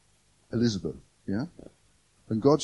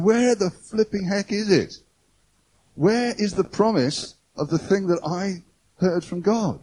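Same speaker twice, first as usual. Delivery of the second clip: exasperated cry; 6 semitones higher than usual. Retold or imitated. Believer waiting on God's promise, mock exasperated. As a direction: ask it like you've waited far too long for God.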